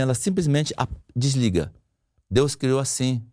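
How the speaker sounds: ordinary talk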